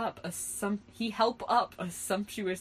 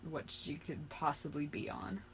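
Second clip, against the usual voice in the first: plain reading voice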